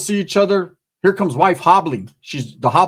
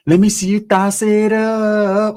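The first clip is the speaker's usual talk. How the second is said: slow hype call; 3 semitones higher, slower than usual. Real, not acted. Hype intro call to audience.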